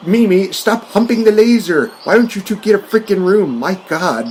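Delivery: high, scolding Doctor Evil voice; mock exasperated scolding. To Mini-Me humping the laser, high mock scolding. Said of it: "It's like".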